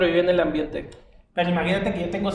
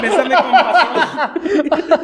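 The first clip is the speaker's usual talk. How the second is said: very slow, much higher and louder, laughing; hammed-up passionate plea; 9 semitones higher, 8 dB louder, much slower than usual. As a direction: stretch bésame con pasión way out like a telenovela. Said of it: "O sea". (súplica melodramática muy lenta, aguda y fuerte)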